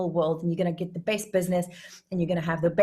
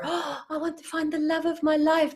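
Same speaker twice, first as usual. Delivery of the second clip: high-pitched romantic gushing; gushing wish; 9 semitones higher than usual. Acted. Person wishing for love of their life, gushing. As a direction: gush with exaggerated longing, pitched up.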